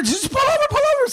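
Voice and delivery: very high mock panic; imitated screaming. Wife urging her husband to stop, mock panic. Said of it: "why the wife was screaming".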